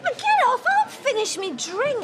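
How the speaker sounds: put-on high, loud drunken squawk; angry protest. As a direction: shout them off you. Drunk mother resists being taken away.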